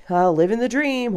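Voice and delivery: mock-chipper small talk; automatic cheery response. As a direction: lightly fake a carefree answer. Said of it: "I say shit like".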